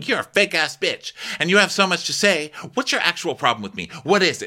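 high-pitched mock yell; retold yelling. Mikhail confronts TJ over his problem, mock yelling. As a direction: raise the pitch in an acted outburst. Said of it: "Mikhail's yelling".

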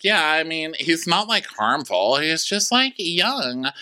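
high, airy mock-innocent tone; high mock girly voice. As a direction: shrug off his faults in a high voice. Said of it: "She's like".

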